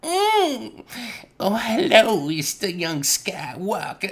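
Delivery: high, slow, theatrical greeting as the character Zero; Zero's voice. Retold or imitated. Friend quoting Zero from the movie, in a high sly put-on voice. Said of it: "Zero's like".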